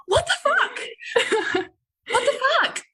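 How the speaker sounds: very high, laughing disbelief; incredulous inner voice. Retold or imitated. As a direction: think it in outraged amusement. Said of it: "So I was thinking".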